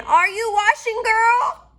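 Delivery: very high, loud witch call through a door. Acted. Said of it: "called through the door again".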